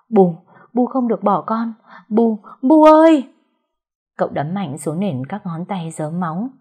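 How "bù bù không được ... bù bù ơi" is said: desperate wailing. Acted. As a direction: cry out for the dead mother. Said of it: "gào khóc trong vô vọng".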